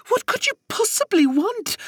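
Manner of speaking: high, loud alarmed cry; a frightened sister crying out at the stranger. Acted. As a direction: fling the question out in alarm. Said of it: "Rosie cried out".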